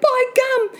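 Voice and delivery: put-on high-pitched shocked young Jack; crying out. Boy realising the giant ate mum, high alarmed cry. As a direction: blurt it high in boyish alarm. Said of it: "Jack cried".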